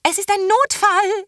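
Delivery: high, loud shout over the wind; shouting back. A narrator's reading. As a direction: yell it back urgently. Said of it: "schrie Terras Mutter zurück".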